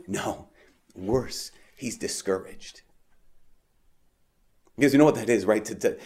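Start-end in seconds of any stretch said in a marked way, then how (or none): 0.1-2.8 soft, slow and weighty; grave reply. Acted. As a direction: let each phrase sink, voice lowered. Repeats the angel's grave answer.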